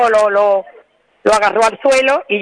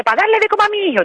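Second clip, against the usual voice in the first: much higher and fast, a whiny plea; mimicking the young robber; 11 semitones higher, faster than usual. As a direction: squeak it out quickly like the kid making excuses. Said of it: "porque dice".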